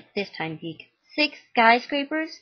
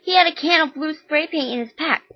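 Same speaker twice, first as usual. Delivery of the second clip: loud, high furious rant; furious mom; 8 semitones higher, 8 dB louder than usual. Acted. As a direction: rage at him for the boy's death. Mom yells at jailed son.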